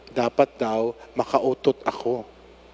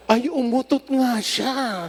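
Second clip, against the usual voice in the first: high, slow amazed exclamation; surprised; 9 semitones higher, slower than usual. Retold or imitated. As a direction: marvel at the moment, drawn out. Retelling the relieving moment. (mataas at mabagal na pagkamangha)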